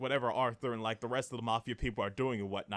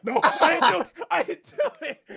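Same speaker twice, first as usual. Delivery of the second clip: higher, louder pleading through laughter; laughing mock plea; 12 dB louder than usual. Ash denying the killing to the cops, laughing mock plea. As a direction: whine your innocence while cracking up.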